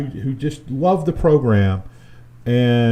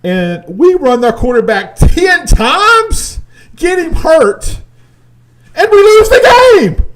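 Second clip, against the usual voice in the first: much higher, louder shouting rant; full rant; 11 semitones higher, 8 dB louder than usual. Real, not acted. Fan melting down over the loss to his cohost, shouting. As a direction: blow up in disbelief, dragging it out. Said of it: "I was about to die".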